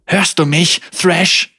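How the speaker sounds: high, loud angry shout; furious yell. Acted. Senna yells at Thrash to leave her head, high and loud.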